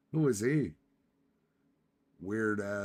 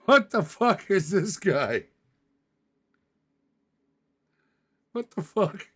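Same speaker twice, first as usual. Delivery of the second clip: much higher, louder laughing what the fuck; baffled outburst; 4 semitones higher, 6 dB louder than usual. Real, not acted. Streamer staring at a photo, high loud bafflement. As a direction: gasp it out, completely thrown.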